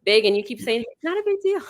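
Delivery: lightly mock exasperation; flustered friend. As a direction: imitate the fretting contestant. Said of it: "'Cause she's like".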